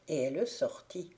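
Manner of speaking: usual speaking voice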